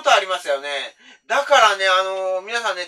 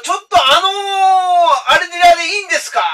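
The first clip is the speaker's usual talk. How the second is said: high, loud, stretched-out then rushed; nervous shouted question; 9 semitones higher, 7 dB louder than usual. Retold or imitated. Driver nervously asking the empty back seat if this is the place. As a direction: call back to the passenger, dreading the answer. Asking imaginary passenger, scared.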